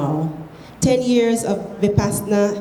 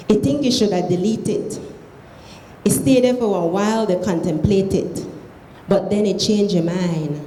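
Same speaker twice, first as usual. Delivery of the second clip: reading aloud in Krio; Sierra Leone Creole. Shown as a demonstration. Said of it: "read it in Creole".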